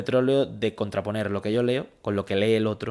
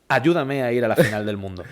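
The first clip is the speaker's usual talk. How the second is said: "Ayúdame a ir a la final del mundo" called out louder, like a pitch; punchy campaign hook; 3 semitones higher, 6 dB louder than usual. Retold or imitated. Speaker recalls his video opener for voters, louder and urgent. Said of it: "Ellos quieren escuchar".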